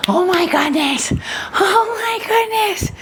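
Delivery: very high, slow cooing at a dog. Real, not acted. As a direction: gush over the puppy, pitch soaring. Greeting the dog excitedly.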